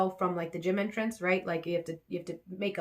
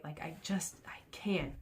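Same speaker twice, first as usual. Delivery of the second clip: whispered self-talk; 3 semitones lower, 10 dB quieter than usual. Real, not acted. Self-talk before run.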